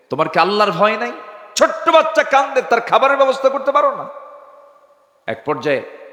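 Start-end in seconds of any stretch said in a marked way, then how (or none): 0.1-4.1 high-pitched acted rebuke; retold sharper scolding. Omar rebukes the mother a second time, high acted scolding. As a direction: scold her hard in a raised, high pitch. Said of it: "এবার ধমক দিয়ে বললেন".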